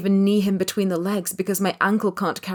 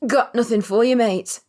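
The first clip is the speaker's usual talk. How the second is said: street voice drawl; 5 semitones higher, 5 dB louder than usual. A narrator's reading. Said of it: "I drawl in my best street voice".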